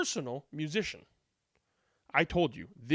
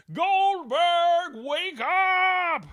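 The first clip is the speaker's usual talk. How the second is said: high loud call; rich dude yelling; 11 semitones higher, 4 dB louder, much slower than usual. Acted. Rich dude yells at dozing Goldberg, high loud call. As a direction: bark the name up high.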